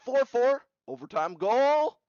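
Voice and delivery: excited goal call. Real, not acted. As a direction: call the sudden winner with real excitement. Calling an overtime goal.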